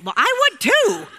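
high, loud and laughing through the words; laughing retort. Real, not acted. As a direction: laugh as you fire back at him.

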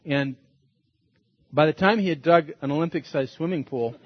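ordinary talk

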